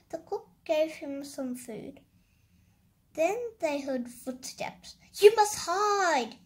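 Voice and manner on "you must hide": loud, higher-pitched, drawn-out cry; urgent cry. A narrator's reading. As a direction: cry out a slow, loud warning. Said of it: "she cried".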